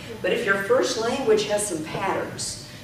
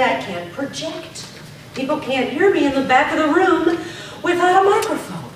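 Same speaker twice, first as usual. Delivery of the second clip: projected quote; 6 semitones higher, 5 dB louder than usual. Retold or imitated. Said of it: "some people say".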